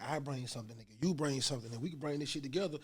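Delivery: ordinary talk, nothing marked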